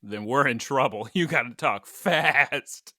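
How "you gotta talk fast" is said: slow mock warning with a long final word; playfully drawn out. Host warning co-host about the timer, comically drawn out. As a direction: stretch out the last word teasingly.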